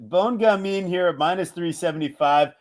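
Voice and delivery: ordinary delivery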